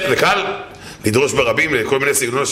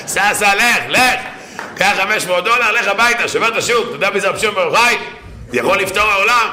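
scolding rebuke; 6 semitones higher than usual. Acted. Acted rebuke of the tzadik.

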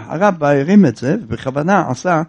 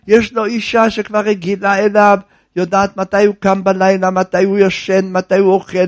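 normal talking voice

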